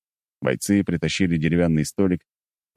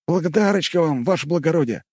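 pleased; 11 semitones higher than usual. Acted. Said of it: "довольно сказал ушастый".

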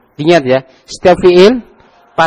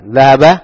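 Dhahaba said louder; stress on Dhahaba; 3 semitones lower, 4 dB louder than usual. Shown as a demonstration. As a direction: isolate and hit Dhahaba. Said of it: "Siapa yang bisa mengi'rab?".

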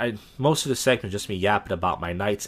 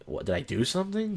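higher, unaggressive asking; insecure question; 5 semitones higher, 4 dB quieter than usual. Retold or imitated. Asking the man staring at him, insecure question. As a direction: ask as if you might be at fault. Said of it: "insecure, like I did something".

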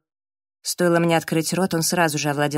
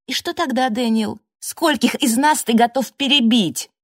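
higher-pitched angry challenge; heated accusation; 5 semitones higher, 3 dB louder than usual. A narrator's reading. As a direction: build into an indignant confrontation. Heated accusation among quarrelling housemates.

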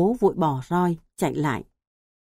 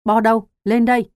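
loud, higher barked command; angry shout; 4 semitones higher, 7 dB louder, faster than usual. A narrator's reading. Father shouting for his hiding son, loud angry command. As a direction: yell for the boy to come up. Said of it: "Bố Bo quát".

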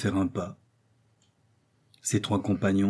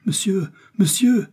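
much higher, slow frantic cry; panicked plea; 11 semitones higher, 5 dB louder than usual. A narrator's reading. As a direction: call out in terror to stop him. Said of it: "fit Joey, se précipitant sur lui".

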